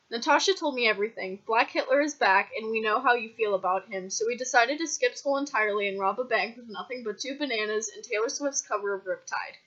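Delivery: ordinary delivery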